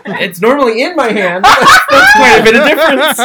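shrieking with laughter, high and loud; laugh-yell the protest; laughing shout. Real, not acted.

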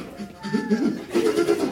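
high, hammed-up crying noise; mock sobbing. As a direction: imitate helpless sobs for laughs. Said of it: "I'm crying".

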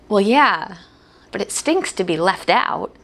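ordinary talk

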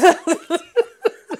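high, loud laugh. Real, not acted. Host cracking up at her own accent bit, laughing. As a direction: burst out laughing.

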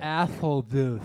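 tongue held, slow and garbled; holding tongue with fingers. Shown as a demonstration. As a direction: say it with tongue pinched. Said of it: "hold your tongue and say it".

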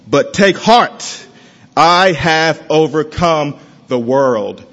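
preacher reading the Bible aloud with weight; scripture reading. Acted. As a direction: lay each phrase down like a promise. Reads John 16:33 aloud.

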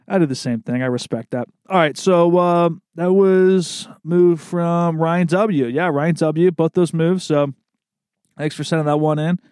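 ordinary talk, nothing marked

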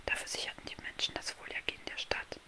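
soft unvoiced bedtime-story whisper; hushed fairy-tale narration; whispered storytelling. A narrator's reading. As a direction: whisper the tale close to the listener.

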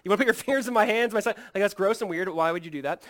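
mock frustration. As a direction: act out a disgusted, impatient telling-off. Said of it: "He's not frustrated. He's not going".

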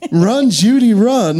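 high, sweet fifties housewife call; housewife line reading. Retold or imitated. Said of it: "I like the line readings".

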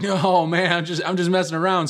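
high-pitched "only kidding" reassurance; hasty backtracking. Retold or imitated. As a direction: imitate a friend backing off a prank. Friend hastily backs off prank.

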